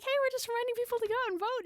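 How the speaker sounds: high, quick, bright reminder at the door; sing-song doorstep greeting; cheery volunteer at the door; chirpy canvasser. Retold or imitated. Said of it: "So I'm like".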